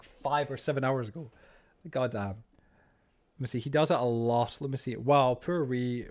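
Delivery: ordinary talk, nothing marked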